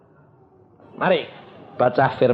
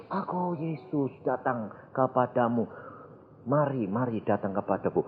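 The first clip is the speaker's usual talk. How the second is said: a hint of a soft, solemn summons; beckoning false Jesus; 8 dB quieter than usual. Retelling a spirit calling the congregation, soft mock-divine beckoning. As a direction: softly imitate a divine call.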